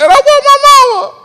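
high, laughing mock sob of a little boy; mock crying child. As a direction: wail it like a homesick kid. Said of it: "I just started crying".

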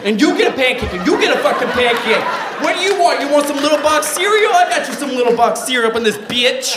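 high, loud, laughing game-show host largesse; Oprah giveaway. Retold or imitated. Treating strangers in the cafeteria, loud laughing giveaway host. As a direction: hand out gifts like a talk-show host.

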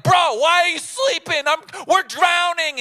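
loud, high mock-panic yell; panicked. Disciples waking Jesus in the storm, panicked. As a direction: wail it in exaggerated alarm.

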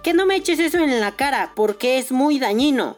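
high mock voice of a scolding lady; mocking nagging lady. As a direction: mimic the preachy stranger. Imitating the annoying lady.

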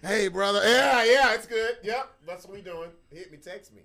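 loud, eager buddy greeting, fading out; brushing someone off fast and loud; D-Made imitation. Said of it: "D-Made will make you cut a conversation short".

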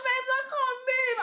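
high, loud wail with laughter in it; hammed-up helpless sobbing plea; mock crying. Sick girlfriend answering shouting boyfriend, mock crying.

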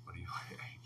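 an unvoiced, soft mock-sexy whisper; sultry whisper. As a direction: breathe it like a flirty line. Repeats a sexy question.

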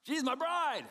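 loud, high-pitched delight; thrilled Adam. Acted. Adam first sees his bride, loudly thrilled. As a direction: whoop it out like a smitten bridegroom. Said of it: "last chapter I was going".

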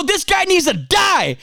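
high-pitched indignant verdict; angry King David. Acted. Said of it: "He's angry".

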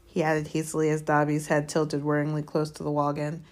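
usual speaking voice

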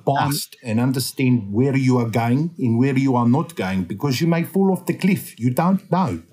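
deliberate, put-on Afrikaans accent. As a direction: give advice in a thick Afrikaans accent. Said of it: "the Afrikaans".

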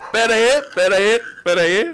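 loud, breathless laughing plea. Real, not acted. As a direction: beg for a pause through laughter. Cracking up at the joke.

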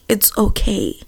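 soft, tender retelling of gran's comfort; hushed and soothing; gentle reassurance. Retold or imitated. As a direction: reassure gently, dropping the volume. Retells gran comforting her on phone.

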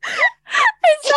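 high screaming laugh, barely words; losing it completely with laughter; shrieking laughter. Real, not acted. Hysterical laughter.

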